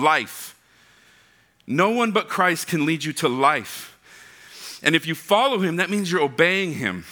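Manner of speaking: normal talking voice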